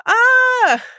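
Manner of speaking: high, loud squealing laugh. Real, not acted. Host laughing at herself for crying, squealing. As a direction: burst out in an embarrassed squeal. Overcome, laughing at own tears.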